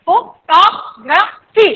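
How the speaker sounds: Photography slowly as fo, tog, ra, phi; four separate beats in photography; syllable by syllable. Shown as a demonstration. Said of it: "चार syllables है". (Photography चार टुकड़ों में, रुककर)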